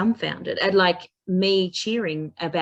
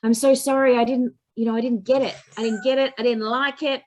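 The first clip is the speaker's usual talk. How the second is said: higher, louder flustered student apology; apologetic student; 6 semitones higher, 3 dB louder, faster than usual. Retold or imitated. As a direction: play the sorry, flustered student. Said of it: "some of my students will go".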